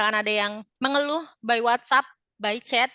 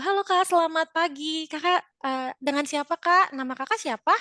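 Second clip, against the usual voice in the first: higher, brighter-pitched seller's hello; friendly customer greeting; 5 semitones higher, 4 dB louder than usual. Shown as a demonstration. Seller asking a new customer's name, friendly greeting. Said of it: "kita tanyakan dulu".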